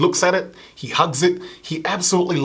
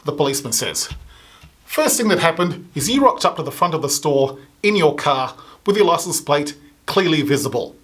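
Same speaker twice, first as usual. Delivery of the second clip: ordinary talk, nothing marked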